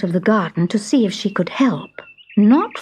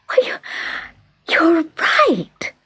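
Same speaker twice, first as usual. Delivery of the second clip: high, happy exclamation; delighted cry; 7 semitones higher than usual. A narrator's reading. Little fairy agreeing with the Fairy Queen, delighted cry. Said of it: "she cried".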